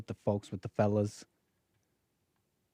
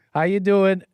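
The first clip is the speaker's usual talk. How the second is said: a bright, higher, louder hello to the chat; louder greeting; 7 semitones higher, 9 dB louder than usual. Real, not acted. Host calling out a louder hello to his stream viewers. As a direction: call out the hello to the whole room.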